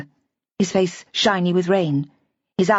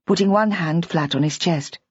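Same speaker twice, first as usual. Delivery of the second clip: ordinary delivery, nothing marked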